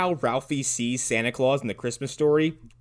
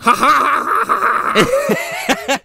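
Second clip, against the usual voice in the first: much higher, slow, loud fake laugh; hammed-up strained Santa laugh; forced Santa laugh; 5 dB louder, much slower than usual. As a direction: squeeze out a hollow ho-ho laugh. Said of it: "he's basically telling Santa to laugh".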